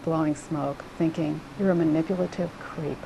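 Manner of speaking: usual speaking voice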